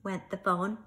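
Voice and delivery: lively, animated storybook reading; storyteller voice, loud and expressive; reading to children. Acted. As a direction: read it out big for little listeners. Reads picture book aloud to children.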